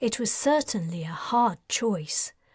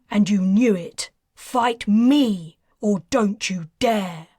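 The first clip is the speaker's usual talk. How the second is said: loud, furious accusation; 6 dB louder than usual. Acted. Patrin berating Merlin, furious and loud. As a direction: tear into the coward.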